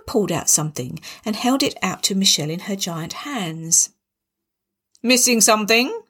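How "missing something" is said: big booming mother-in-law; boomed. A narrator's reading. Barbara confronts Michelle, booming. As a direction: boom it out like a grand matriarch. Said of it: "she boomed".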